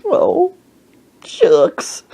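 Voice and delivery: high wail dropping on the last word; hammed-up weepy old man; mock crying. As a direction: imitate a sobbing old man. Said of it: "He kept saying".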